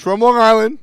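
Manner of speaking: put-on high, loud Long Island accent burst; mock Long Island. Host introducing a contestant, loud mock Long Island. Comic aside on contestant's hometown.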